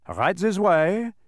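loud, high, drawn-out guide's call; instructing guide. A narrator's reading. Dodecahedron leading visitors through the mine, loud instruction. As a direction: call the visitors along slowly. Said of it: "instructed the dodecahedron".

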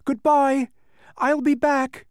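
high, loud calling back; shouted farewell. A narrator's reading. Boy promises return while driving off, shouting. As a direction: holler it back to the crowd. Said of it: "he shouted".

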